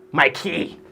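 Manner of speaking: Mikey slapped out carelessly with a hard KEY; tongue-slapped name. Retold or imitated. Host mocks Grenadians saying Mikey, slapping it out. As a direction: slap the tongue all over Mikey. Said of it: "They just slap the tongue all over it".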